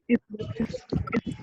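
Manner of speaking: choppy audio cutting out; radio breaking up. Real, not acted. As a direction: voice dropping out mid-word. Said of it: "आवाज थोड़ा cut रहा है".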